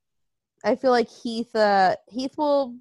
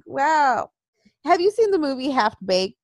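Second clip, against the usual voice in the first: normal talking voice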